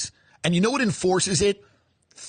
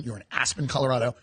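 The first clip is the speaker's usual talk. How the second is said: ordinary talk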